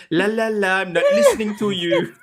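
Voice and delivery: mock sing-song refusal. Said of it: "Elaine was standing in front of the judge just going".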